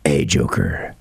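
deep, slow, menacing superhero voice; Batman. Pretend. Host plays superheroes as with the son, deep menace. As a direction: confront a villain in a very low voice. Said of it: "I always have to be the Batman".

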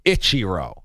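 "Ichiro" pitched low as ITCHY-roh; whitewashed pronunciation. Retold or imitated. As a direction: mimic an Anglicized "Ichiro" with short 'itch'. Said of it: "whitewashed there".

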